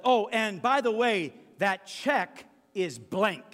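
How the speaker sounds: dentist's parting words, drawn out; dramatic reveal. Retold or imitated. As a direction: land the punchline slowly. Dentist's parting surprise line.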